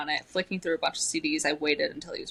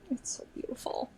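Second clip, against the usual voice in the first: whisper; 6 semitones higher, 9 dB quieter than usual. Real, not acted. Swooning whisper.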